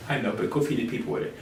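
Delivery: Jesus quietly sending disciples to feed; calm Jesus. Acted. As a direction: answer like a calm teacher, hushed.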